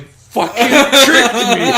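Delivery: high, loud, slow laughing cry; laughing outrage. Retold or imitated. Chat fooled by the toucan, laughing loud. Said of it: "everyone's just like".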